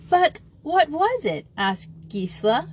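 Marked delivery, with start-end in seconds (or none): 0.1-1.5 high, puzzled questioning; bewildered. A narrator's reading. Gisela asking about the joke, bewildered. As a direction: ask it utterly at a loss.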